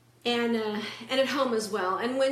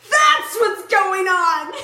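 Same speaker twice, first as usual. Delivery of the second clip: sudden loud, high, slow exclamation; burst out; 7 semitones higher, 11 dB louder, much slower than usual. Retold or imitated. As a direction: blurt it out like a eureka. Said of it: "she just burst out in the audience".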